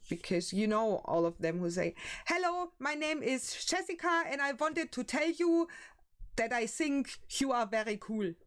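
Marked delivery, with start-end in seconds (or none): 2.3-8.3 mock German accent. Streamer mocking how film Germans speak, hammed-up German accent. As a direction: parody a film German introducing herself in a German accent. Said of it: "not to sound too German".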